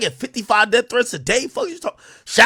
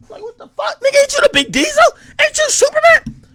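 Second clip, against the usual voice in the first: pitched way up in disbelief, fast; incredulous. Real, not acted. Reacting to Shaq's threats, high incredulous squeal. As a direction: squeal it like you can't believe it.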